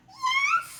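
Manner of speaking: high loud squeal of joy; excited Piggie cheer. Acted. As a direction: shout it like a thrilled piglet.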